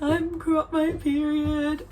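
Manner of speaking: drawn-out high wail, retold crying; mock crying. Tells mum on the phone her period came, mock crying. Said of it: "And I was crying".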